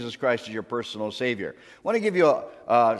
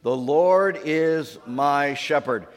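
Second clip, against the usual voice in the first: slow, louder unison reading; slow recitation; 4 dB louder, much slower than usual. Shown as a demonstration. Congregation repeating after pastor, slow and loud. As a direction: say it together, slowly and louder. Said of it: "Can you say that with me?".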